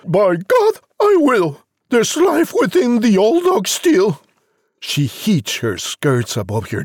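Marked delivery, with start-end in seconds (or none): 0.0-4.2 high, determined mother voice; hammed-up high resolve; defiant cry. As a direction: declare it boldly as a feisty mum, pitched up. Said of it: "The mother cried".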